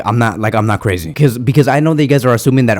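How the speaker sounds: ordinary talk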